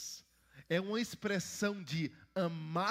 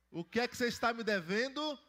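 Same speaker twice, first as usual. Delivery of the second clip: higher and louder call to the crowd; louder prompt; 7 semitones higher, 6 dB louder than usual. Shown as a demonstration. Pastor to children who did not answer, calling louder. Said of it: "Não tô ouvindo as crianças".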